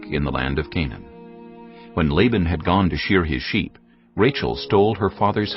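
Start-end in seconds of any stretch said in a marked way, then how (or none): none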